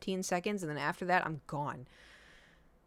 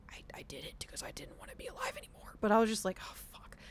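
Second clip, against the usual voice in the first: fast, hushed whisper; 6 dB quieter, faster than usual. Real, not acted. Telling viewers about the attempt at seventeen, a fast hushed whisper. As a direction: drop to a whisper like a secret.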